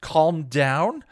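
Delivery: high, loud outraged echo. A narrator's reading. Buffy fires back at Xander, outraged. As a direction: throw his words back at him.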